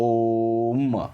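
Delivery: ओम with a long ओ closing on म; drawn-out Om. Shown as a demonstration. Teacher showing how Om is pronounced, ओम drawn out. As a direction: stretch ओम slowly, landing on the final म. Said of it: "फिर last में गिरोगे म पर".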